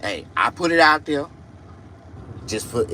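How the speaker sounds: normal talking voice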